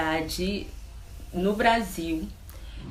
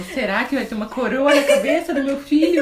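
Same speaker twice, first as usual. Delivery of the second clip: mock wonder; 5 semitones higher, 7 dB louder than usual. Joking about crowned baby.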